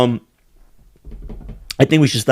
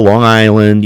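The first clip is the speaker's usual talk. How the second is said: ordinary delivery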